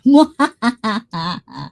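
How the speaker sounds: drawn-out mock evil cackle; hammed-up Halloween cackle; emphasized witch laugh. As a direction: put on a high witchy "mwahaha". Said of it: "gonna emphasize the laugh for tonight".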